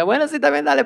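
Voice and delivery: mock-agreeable, pitched well up; joking. Young coworker joking back at a boss's request, joking. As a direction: brush it off as a joke, high and light. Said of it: "me respondían con una broma".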